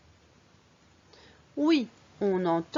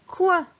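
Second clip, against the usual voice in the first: "coin" pitched high and loud as one syllable; model for repeating; 9 semitones higher, 7 dB louder than usual. Shown as a demonstration. Teacher blends C with ouin for the pupil, emphatic. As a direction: punch out "coin" high for the learner to copy. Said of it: "À ton tour".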